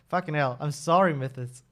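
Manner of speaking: ordinary delivery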